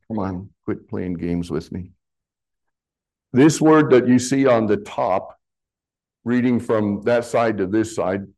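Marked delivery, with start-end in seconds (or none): 0.1-1.9 low, soft aside under the breath; muttered aside. Real, not acted. Preacher to his misbehaving slides, muttered aside. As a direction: quietly grumble at the gadget.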